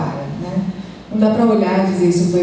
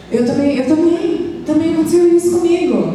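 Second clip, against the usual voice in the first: put-on higher, a touch of the child's eager voice; childlike echo; 9 semitones higher than usual. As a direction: echo the child lightly, half playing her. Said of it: "Isso aí que você tá dizendo". (eco leve da voz da criança, mais aguda)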